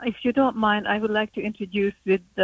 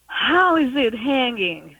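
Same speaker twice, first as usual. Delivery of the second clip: mock yell, higher and drawn out; retold yell; 5 semitones higher, 3 dB louder, slower than usual. Host father greets the new arrival, imitated hearty yell. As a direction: imitate a loud, slow greeting. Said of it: "very loudly he yelled".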